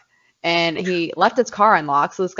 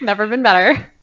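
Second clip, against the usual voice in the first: sarcastic, a little higher and louder; mock cheerful; 4 semitones higher, 4 dB louder than usual. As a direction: play it like everything's perfect. Joking about always being sick.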